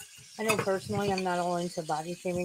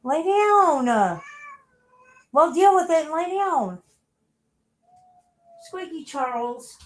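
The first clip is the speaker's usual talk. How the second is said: loud, higher stern command; scolding the cat; 10 semitones higher, 8 dB louder, slower than usual. Real, not acted. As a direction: order the cat down firmly.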